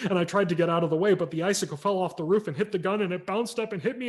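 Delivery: put-on fast, high, rushed kid's excuse; boy spilling his excuse to mother, rushed and rising; frantic excuse. A narrator's reading. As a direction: babble it out like a guilty kid.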